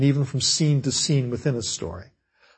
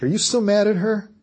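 lightly voiced questioner; 7 semitones higher than usual. Mary questioning Glenn in example dialogue.

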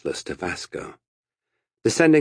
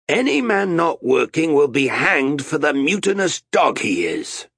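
loud, high threatening harangue; stern captain's orders; 8 semitones higher, 4 dB louder than usual. A narrator's reading. Captain facing mutinous sailors, loud threatening orders. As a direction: lay down the law to the crew.

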